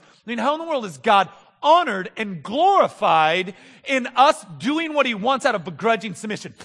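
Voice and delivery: usual speaking voice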